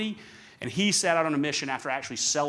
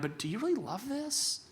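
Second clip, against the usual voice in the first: much higher, softer doubting question; incredulous question; 13 semitones higher, 4 dB quieter than usual. Retold or imitated. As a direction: ask it in disbelief, way up high. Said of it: "And you're like".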